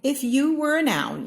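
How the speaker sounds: high, loud announcing refrain; bright proclaiming refrain; excited refrain. A narrator's reading. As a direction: call it out like a title.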